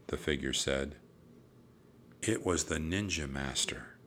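ordinary delivery, nothing marked

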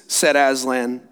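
plain reading voice

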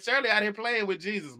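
mock gossip. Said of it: "they said".

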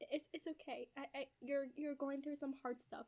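soft, halting mock comfort; gentle reassurance. Retold wife forgiving her husband, gently reassuring. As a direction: soothe him in a small voice. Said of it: "And then Verity's like".

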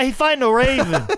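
hyped Boston local laughing through it; Boston townie accent. Pretend. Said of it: "why don't you watch The Town".

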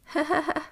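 high, short burst of giggles; acted laugh. A narrator's reading. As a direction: laugh playfully as the character. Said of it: "She laughed".

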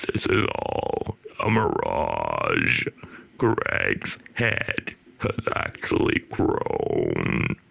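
extremely slow, deep croak on indrawn breath; amateur video game villain impression, sucked-in rasp; inhaled bad-guy voice. Shown as a demonstration. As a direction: drag each word out while breathing in. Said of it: "So I'll do it again".

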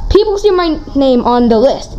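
usual speaking voice

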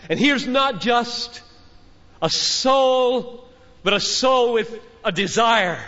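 fervent preacher lifting the crowd; preaching climax. Acted. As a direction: build it phrase by phrase like a crescendo.

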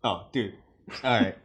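normal talking voice

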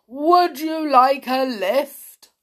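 slow, loud booming offer; big friendly elephant voice. A narrator's reading.

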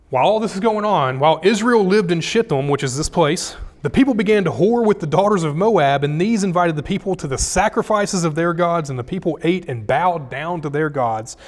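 scripture reading. Acted. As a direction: read the passage out forcefully. Reads scripture passage aloud.

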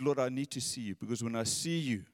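quiet yearning prayer. Acted. David telling the Lord he needs him, hushed. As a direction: let the words fall away softly. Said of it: "he just said".

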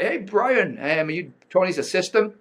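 keen to get through to the star; eager. Retold or imitated. Speaker asking the sales rep if he's the assistant, eager.

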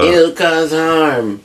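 high, drawn-out mocking whine; exaggerated sing-song objection; mock complaint. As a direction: stretch it out in mock concern. Mocking a free speech worry.

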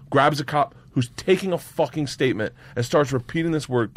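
ordinary delivery

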